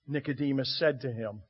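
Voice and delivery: ordinary talk, nothing marked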